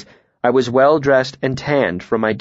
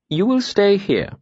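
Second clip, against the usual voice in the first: high-pitched, level instruction from a mayor; evenly voiced lordly order; 9 semitones higher than usual. A narrator's reading. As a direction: state it evenly, as settled fact. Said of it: "he said evenly".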